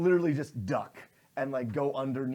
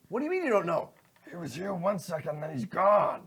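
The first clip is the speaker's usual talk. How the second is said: irritated exchange; 5 semitones higher, 5 dB louder than usual. Acted. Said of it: "it's like".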